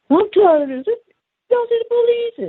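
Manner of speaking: very high, squeaky mock voice; agitated bystander. Someone complaining as the kids get distressed, agitated high imitation. Worked-up person at the distressed scene.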